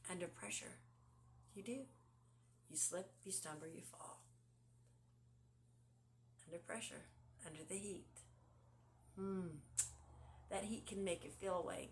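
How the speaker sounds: ordinary delivery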